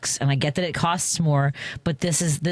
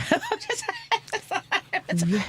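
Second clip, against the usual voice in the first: very high squeaky laugh; high, drawn-out acted titter; mock awkward laugh; 4 dB quieter than usual. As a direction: squeal out a strained little laugh. Storyteller's awkward reaction to attendant.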